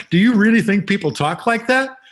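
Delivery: high, loud incredulous question; retold scoffing critic. Critic laughing at the playwright's dialogue, high loud incredulous scoff. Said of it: "People have always laughed at my dialogue".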